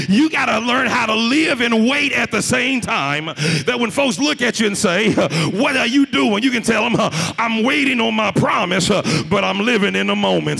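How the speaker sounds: loud testimony with rhythmic punctuating breaths; full-force preaching. Real, not acted. Preacher praising God for blessings, fervent full-force preaching. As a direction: drive each phrase home to the congregation.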